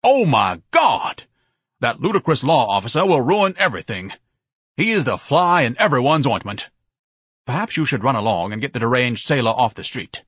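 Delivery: high, forceful outburst building in urgency; alarmed tirade. Acted. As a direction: declaim a pompous panic. Ignatius panics, orders sailor removed.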